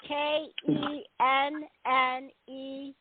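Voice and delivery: each letter slow and separate; slow spelling. Shown as a demonstration. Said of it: "I'm gonna spell it out for everybody".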